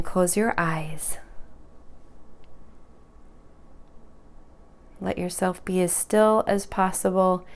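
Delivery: soft, unhurried meditation voice with long pauses; guided meditation. Real, not acted. Yoga teacher guiding students through breathing, guided meditation voice. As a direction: gently guide them into stillness, leaving space between phrases.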